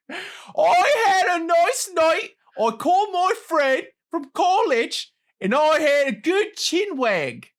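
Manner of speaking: high, drawn-out hammed-up British accent. Shown as a demonstration. Quiz host rereading the sentence to appease contestant, British accent. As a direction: put on a big, slow, high British voice. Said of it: "read it in a British accent".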